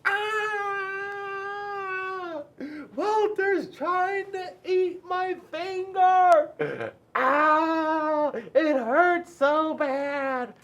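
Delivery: high, loud, slow crying scream; screaming in pain. A narrator's reading. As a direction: shriek as a fish bites your finger. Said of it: "Penelope screamed".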